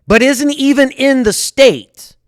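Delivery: high, drawn-out disbelief; incredulous. Retold or imitated. Parole officer recapping his absurd case, incredulous.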